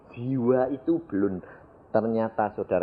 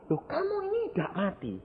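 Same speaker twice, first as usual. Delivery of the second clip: amazed bearer; 9 dB quieter, faster than usual. Retold or imitated. Bearer amazed at revived man.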